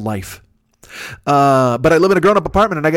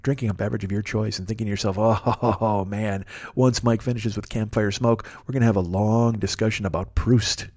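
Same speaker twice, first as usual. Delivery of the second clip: low, much softer, intimate ASMR murmur; mock ASMR; 4 semitones lower, 7 dB quieter than usual. To listeners imagining his smoky apartment, a softer mock ASMR voice. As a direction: drop low and soft as if doing ASMR for the listener. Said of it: "I'm gonna get real low and go, uh, A M S R on you".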